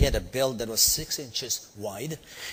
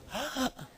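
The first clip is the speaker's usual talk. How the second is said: high gasp; 12 semitones higher, 6 dB quieter than usual. Retold or imitated. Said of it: "They went like Home Alone".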